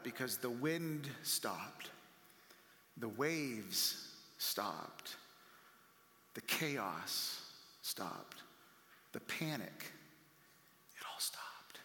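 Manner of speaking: slow, low, softening to a whisper; preacher letting the storm die away, whispered; fading hush. Acted. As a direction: let each stop sink into silence.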